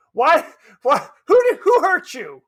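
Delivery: high, loud, pleading why; exasperated outburst. Real, not acted. Host asks the harsh critic who hurt him, loud and exasperated.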